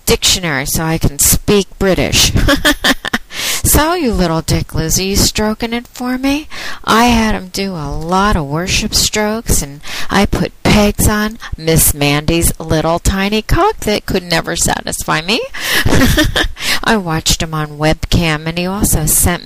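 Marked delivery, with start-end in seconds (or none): 2.4-3.6 mocking laugh. Real, not acted. Mocking the caller. 15.5-16.8 mocking laugh. Real, not acted. Mocking the caller.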